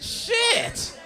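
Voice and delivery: high shocked yelp. Retold or imitated. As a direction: squeal it in disbelief. Shocked reaction to six months.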